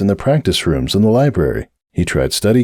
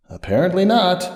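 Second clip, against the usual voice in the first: higher, softer, dry self-mockery; wry bitterness; 10 semitones higher, 3 dB quieter than usual. A narrator's reading. Sunburst mocks his own hope, dry and bitter. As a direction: undercut the hope flatly.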